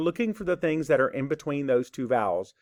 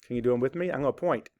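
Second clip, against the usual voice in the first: usual speaking voice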